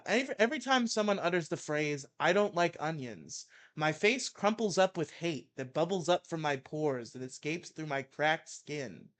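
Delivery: heated mock-angry reading; ranting article read. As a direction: read it like a furious rant. Reads Maddox rant aloud.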